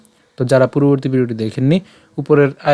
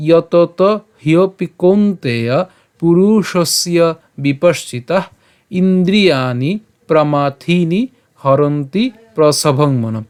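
slow, careful shloka reading without melody; measured Sanskrit model reading; spoken verse recitation; 3 semitones higher, 3 dB louder, much slower than usual. Shown as a demonstration. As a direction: read word group by word group. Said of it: "আমি আবার বলছি".

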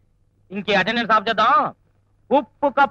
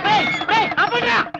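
shout; 10 semitones higher than usual. Acted. Teacher stops the drama.

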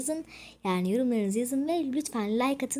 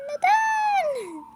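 high; 5 dB louder than usual. Pretend. Repeats neden climbing in pitch.

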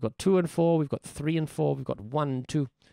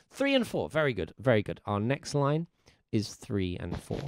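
normal talking voice

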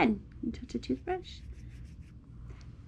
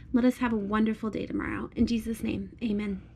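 soft, hushed prayer voice; bedtime prayer; 5 dB quieter than usual. Real, not acted. Mom prays over her little girl at bedtime, hushed prayer.

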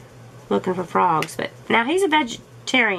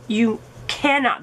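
ordinary talk

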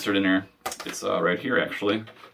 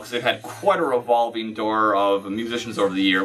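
usual speaking voice

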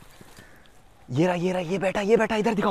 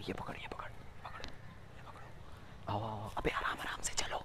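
whisper; 6 semitones lower, 21 dB quieter than usual. Real, not acted. Stalking the bird, urging quietly.

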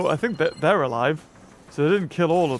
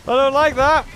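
louder, higher nervous protest; alarmed; 7 semitones higher, 5 dB louder than usual. Real, not acted. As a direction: blurt it out in alarm. Alarmed at dangerous creature.

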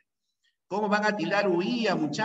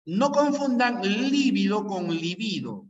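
No confundan lívido con libido, slow and clear; a teacher's slow warning, lívido then libido; 4 semitones higher, slower than usual. Shown as a demonstration. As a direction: spell out lívido and libido word by word. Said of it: "date cuenta en la pronunciación".

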